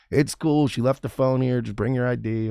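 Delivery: a kind officer putting him at ease; sweet female cop. Retold or imitated. Police officer reassuring the man who lost his phone, sweet.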